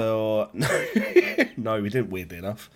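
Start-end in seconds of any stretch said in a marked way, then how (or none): 0.5-1.5 high surprised laugh. Real, not acted. Laughing at the stripper joke.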